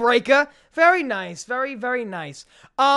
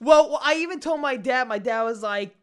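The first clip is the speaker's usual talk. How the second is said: ordinary talk, nothing marked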